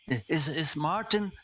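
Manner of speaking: a hint of a hesitant, anxious man; nervous character voice. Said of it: "And he says".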